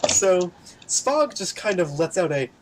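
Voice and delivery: ordinary talk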